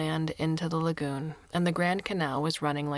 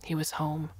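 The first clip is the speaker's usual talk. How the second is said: usual speaking voice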